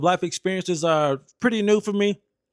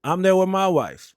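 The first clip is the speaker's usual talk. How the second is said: ordinary delivery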